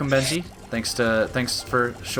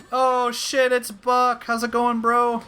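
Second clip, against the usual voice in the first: excited greeting; 10 semitones higher, slower than usual. Real, not acted.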